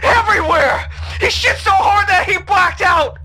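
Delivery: hysterical shouting. Acted. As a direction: wail it out in hysterics. Hysterical report.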